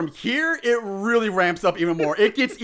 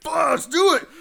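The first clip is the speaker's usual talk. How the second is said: higher-pitched psych-up shout; pumped up; 7 semitones higher than usual. Retold or imitated. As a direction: hype yourself up for a fight. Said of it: "he pumps himself".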